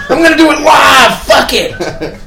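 high, loud shouted anchor rant; Bill O'Reilly freak out. Retold or imitated. Quoting the anchor's on-air meltdown, high and shouted. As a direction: yell it like a furious news anchor. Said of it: "the Bill O'Reilly freak out".

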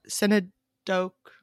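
Synedoke said slowly as SIN-uh-doke; mispronounced word. Real, not acted. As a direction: sound out Synedoke hesitantly, unsure of it. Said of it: "however you say that".

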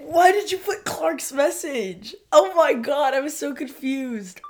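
high-pitched, giddy protest; excited exasperation. Real, not acted. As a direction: blurt it out, still overwhelmed. Giddy protest about the disguised note.

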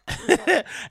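high giggle mid-sentence; real high chuckle; laughing. Real, not acted. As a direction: crack up while reading an insult. Laughs while reading the insult.

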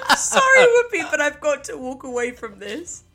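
imitating the retort, starting high and loud; laughing mock apology. Imitating the friend's retort to Whoopi Goldberg, laughing mock apology. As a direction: echo the line while cracking up.

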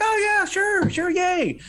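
high cheerful voice. Retold or imitated. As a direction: chirp it like a delighted old man. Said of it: "He's like".

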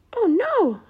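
high, loud mock dismay. Stella hearing Badger's trouble, high and dismayed. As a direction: gasp it out in alarm.

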